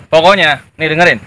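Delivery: loud thug barking orders; bully your men into obeying; bullying boss. Acted. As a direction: gang boss to henchmen, loud and bossy. Gang boss bullies henchmen.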